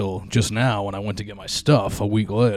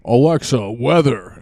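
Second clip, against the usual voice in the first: louder barked command to Alexa; imitating dad; 8 dB louder, slower than usual. Dad ordering Alexa each morning, loud mock bark. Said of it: "he goes".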